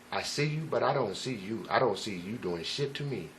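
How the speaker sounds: soft, low and unbothered; quietly certain, no fear at all; calm. Retold or imitated. Staring down a gunman, calm and soft. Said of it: "my feeling was very calm".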